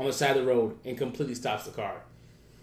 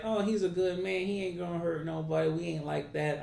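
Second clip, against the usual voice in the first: lightly imitated reassuring woman, pitched higher; weird raspy voice; 5 semitones higher, 4 dB quieter than usual. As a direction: mimic the girlfriend vouching for him. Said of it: "some kind of weird raspy voice".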